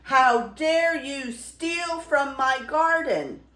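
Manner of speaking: loud, high, drawn-out roar of outrage; bellowing beast. A narrator's reading. Beast catching a thief in the garden, bellowing. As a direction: bellow it slowly and indignantly. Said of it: "bellowed the beast".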